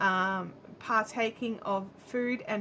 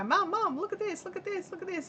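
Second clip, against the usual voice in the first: imitating eager kid; 9 semitones higher than usual. Daughter showing mum things to buy, mock eager pleading. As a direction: beg like a kid in a shop.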